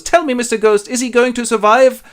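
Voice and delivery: high-pitched, loud weepy pleading; mock weeping. Scrooge begs the ghost about Tiny Tim, mock weeping. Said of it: "has to weep like a girl".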